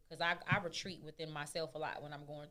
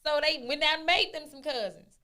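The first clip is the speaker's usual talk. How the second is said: much higher and louder, fired up; heated rant; 10 semitones higher, 7 dB louder than usual. Real, not acted. Defending people who call friends cousins, fired up. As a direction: get worked up defending chosen family.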